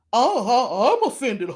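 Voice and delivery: hammed-up offended old-man voice; mocking old-man imitation. YouTuber mimicking the offended Necrosis, mocking old man. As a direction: put on a mock old man taking offence. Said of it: "she was trying to sound like Robert".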